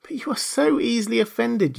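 high, plaintive protest; pleading. A narrator's reading. Alice excusing herself to mouse, pleading. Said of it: "pleaded poor Alice".

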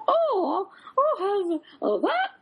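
high squeaky alien voice. Retold or imitated. Retelling the alien in the cinema ad, high squeaky voice. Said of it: "He's like".

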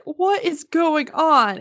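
high, slow, imitating bewilderment; stunned disbelief. Appraiser's wife demanding an explanation of the bids, stunned. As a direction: stretch it out in mock astonishment.

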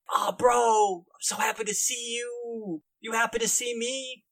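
high-pitched, overjoyed dude-bro greeting; mock-happy bro character voice; gloating bro boss. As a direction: play a bro hammily thrilled at his captive. Game master voices gloating guildmaster.

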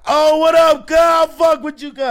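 shouted high hype greeting, calling across the lot; loud catcall. Retold or imitated. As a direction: holler it up high like an excited stranger. Catcaller hollering from the street.

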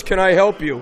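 normal talking voice

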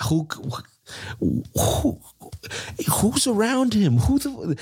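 a disbelieving squeak of a question; incredulous. Real, not acted. Ranting about the coach's note to the ref, voice leaping up in disbelief. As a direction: pitch jumps way up in disbelief.

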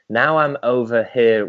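usual speaking voice

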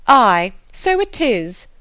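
loud, slow, drawn-out exclamation; agitated mother's exclamation; crying out. A narrator's reading. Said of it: "cried her mother".

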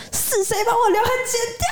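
high, tearful angry demand; crying angry little girl; angry child. Retold or imitated. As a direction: cry out who cut my bangs, upset. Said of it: "非常生气说".

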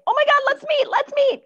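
very high, loud, eager squeal; squealing excited-dog voice; overexcited friendly dog. Acted. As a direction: burst out like a dog desperate to say hello. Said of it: "they might be barking and saying".

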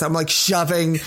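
ordinary talk

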